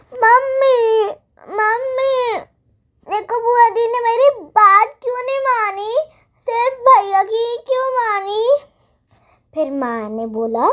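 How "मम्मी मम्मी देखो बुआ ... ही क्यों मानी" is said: high, loud whining cry to mum; shouting child. Acted. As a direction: wail to mum like a hurt child. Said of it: "जोर से चिल्लाकर बोला".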